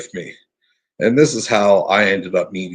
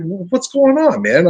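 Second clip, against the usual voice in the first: surprised question; 8 semitones higher than usual. Retold or imitated. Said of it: "I was like".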